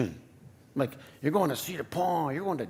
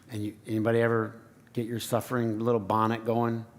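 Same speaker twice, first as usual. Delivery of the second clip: normal talking voice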